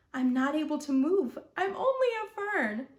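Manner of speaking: a light, stern plant voice; stern fern. A narrator's reading. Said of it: "sounding stern".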